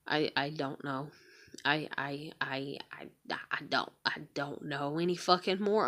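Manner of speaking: real, barely voiced whisper, falling apart; broken whisper. Real, not acted. Worn-out mother venting alone, real broken whisper. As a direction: let the words break into a whisper.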